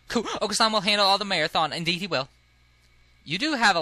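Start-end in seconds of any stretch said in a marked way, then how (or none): 0.1-2.3 high, fast cartoon bird boast; speedy bird character; excitable bird. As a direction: chirp it out with eager confidence. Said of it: "I don't know why I gave him that voice".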